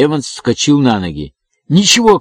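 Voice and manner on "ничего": high, loud cry of despair; breaking-voiced shout. A narrator's reading. Evans shouting at finding the box empty, voice breaking. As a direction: cry it out as if the voice might crack. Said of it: "крикнул он срывающимся голосом".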